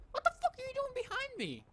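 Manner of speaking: very high, fast squawk; startled outrage. Real, not acted. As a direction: shriek it fast in alarm. Startled by enemy behind him.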